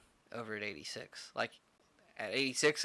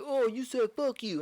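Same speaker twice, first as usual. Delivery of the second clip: high, accusing mock whine; a hammed-up indignant drunk; mocking whiny customer; 12 semitones higher, 4 dB louder than usual. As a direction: imitate him whining, way up high.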